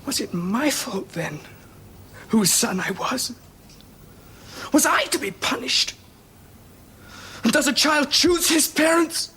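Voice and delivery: tearful pleading. Acted. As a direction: plead through tears, rising to a cry. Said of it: "Now, don't cry".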